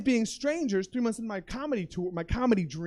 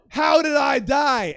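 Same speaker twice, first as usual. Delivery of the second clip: slow, loud, higher cry of confusion; panicked wail; 4 semitones higher, 8 dB louder, much slower than usual. Retold or imitated. Man in purgatory asking friends, panicked wail. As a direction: wail the question, stretching each word.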